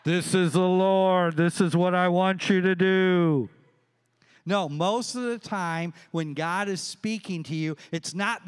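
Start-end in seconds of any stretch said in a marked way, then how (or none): 0.0-3.5 loud, sustained mock-divine announcement; booming voice of God. Preacher mocking how we expect God to speak, booming. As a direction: boom it out like God from the sky. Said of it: "And, and God".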